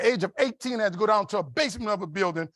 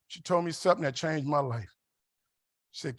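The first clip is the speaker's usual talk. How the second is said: ordinary talk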